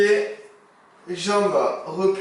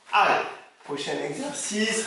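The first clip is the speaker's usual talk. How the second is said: ordinary talk, nothing marked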